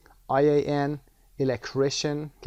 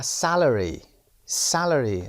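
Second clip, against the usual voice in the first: Salary with the first syllable sa said louder, slowly; stress on SAL; 5 dB louder, much slower than usual. Shown as a demonstration. Teacher drills Salary for the class, first syllable stressed. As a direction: land on the start of Salary. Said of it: "استرس روی قسمت اس گذاشته".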